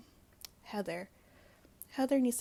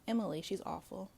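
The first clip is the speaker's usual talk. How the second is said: ordinary delivery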